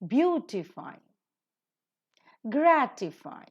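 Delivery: Justify, classify, beautify, gratify, modify, nullify, satisfy, each stressed on the first syllable; JUS-tify, CLAS-sify, BEAU-tify, GRAT-ify, word by word; antepenultimate stress. Shown as a demonstration. Teacher models I F Y words, Justify to satisfy stressed third from last. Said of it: "the stress is taken up at the antepenultimate syllable".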